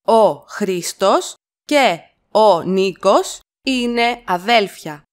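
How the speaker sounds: slow, with αδέλφια set apart; slow it down for copying; slow chunked repeat. Shown as a demonstration. Teacher to learners, slow chunked model. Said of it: "teacher repeats in chunks".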